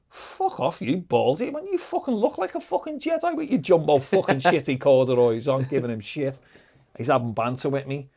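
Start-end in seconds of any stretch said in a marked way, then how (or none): 0.1-5.5 high-pitched mock-outraged heckle; retold cheeky insult. Prisoner insulting a bald stranger in banter, high and cheeky. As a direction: pitch it up and reel off the abuse.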